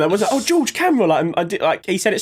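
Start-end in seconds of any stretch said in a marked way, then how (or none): 0.3-1.0 high, unbothered deadpan; mock calm warning. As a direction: say it as if nothing is wrong. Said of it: "so calmly".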